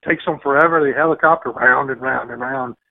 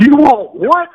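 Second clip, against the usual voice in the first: high, loud mock disbelief; incredulous; 14 semitones higher, 8 dB louder, slower than usual. Clerk baffled by the hunting license request, high incredulous squeak. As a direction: squeak out her shock. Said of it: "She was like".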